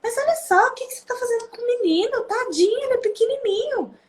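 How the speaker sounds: high-pitched, pitying granny voice; protesting grandmother. Retold or imitated. Grandmother defending the little boy to his mother, pitying protest. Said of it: "a avó fala".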